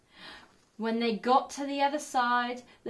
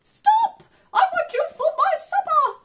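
much higher, loud squealing pig voice; grunting pig; 11 dB louder than usual. A narrator's reading. Pig grunting at the gingerbread man, loud and high.

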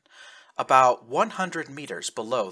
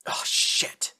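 gasped out under the breath, airy and unvoiced. Trigg gasps after falling into a snowbank. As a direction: gasp it as you hit the snow. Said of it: "Trigg gasped".

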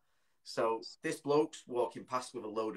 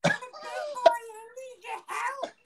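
put-on indignant, very high squeaky toddler; baby voice; 3 dB louder, slower than usual. Prank child correcting the bloke, indignant squeaky baby voice. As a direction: drag it out in a piercing tiny squeal. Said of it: "The, the baby voice".

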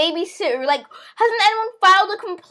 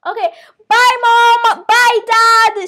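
loud, raised farewell call; calling goodbye; 6 semitones higher, 9 dB louder, much slower than usual. Pretend. Child waving off mom and dad, loud cheerful call. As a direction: call out after departing parents.

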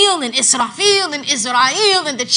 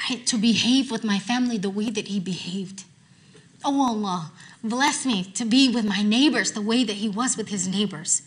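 soft prayer; 5 dB quieter than usual. Shown as a demonstration. Models a personal supplication.

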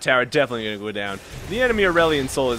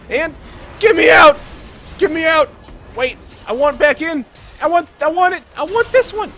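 high, loud, urgent yells; frantic shouting; 9 dB louder than usual. Real, not acted. Player desperate to get out of the fight, frantic shouting. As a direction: cry out in a panic mid-fight.

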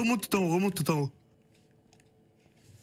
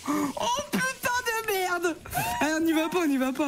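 shaken yelling; 12 semitones higher than usual. Real, not acted. Après le cri, effrayé.

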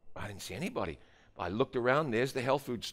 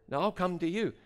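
higher-pitched, hurried-sounding counter-offer; protesting; 7 semitones higher than usual. Retold or imitated. Young man refusing the pastor's visit, protesting. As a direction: object politely, pitched up.

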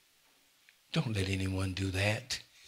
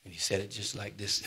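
ordinary talk

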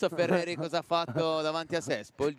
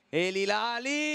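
drawn out, higher, building to the shot; rising excitement; 6 semitones higher, slower than usual. Acted. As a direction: stretch the name as the shot comes. Telecronaca, tiro verso la porta. (telecronaca che sale di tensione)

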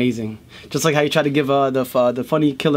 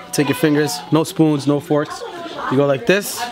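normal talking voice

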